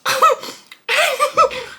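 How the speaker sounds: loud high-pitched burst of laughter; high squealing laugh. Real, not acted. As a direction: cackle at your own joke. Laughing at being broke.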